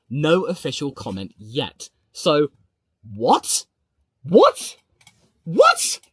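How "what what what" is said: rising disbelief, building to a squeak. Real, not acted. Streamer reacting to shock news, rising disbelief.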